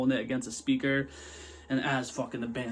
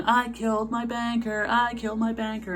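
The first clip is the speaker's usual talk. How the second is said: mock yelling; 9 semitones higher, 4 dB louder than usual. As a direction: imitate a voice yelling it out, stretched and high. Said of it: "the voice is just yelling".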